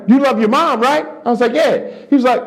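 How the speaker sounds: high, pushy know-it-all preaching; hammed-up Patrice selling his philosophy; mock Patrice lecturing. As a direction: Patrice preaching love to the young comic.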